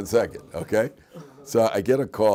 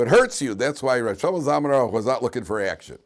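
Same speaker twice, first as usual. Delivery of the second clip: usual speaking voice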